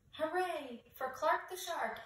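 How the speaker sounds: high, joyful group cheer. A narrator's reading. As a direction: cheer for the hero. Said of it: "everyone cheered".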